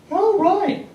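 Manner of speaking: a high, understated "oh, right" from the guest; flat guest reaction. Retold or imitated. Guest told of a fortune, mock nonchalant. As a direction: barely react to the huge valuation, mock nonchalant.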